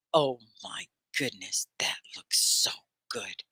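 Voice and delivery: breathy whispered awe over pastries. Real, not acted. Teacher admiring a pastry to her class, whispered. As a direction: hush it like it's too good to say aloud.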